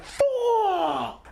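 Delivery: very high drawn-out cry; imitated outcry. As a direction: mimic a startled sister crying out. Sister cries out at the light.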